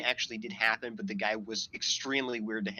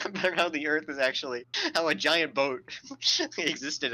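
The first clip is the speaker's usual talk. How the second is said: louder, barely holding back laughter; laughing through words; 4 semitones higher, 4 dB louder than usual. Real, not acted. Streamer retelling a creationist's excuse, laughing as he talks. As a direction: crack up while telling it.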